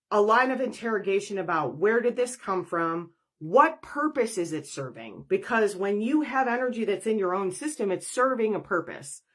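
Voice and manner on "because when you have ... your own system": ordinary talk, nothing marked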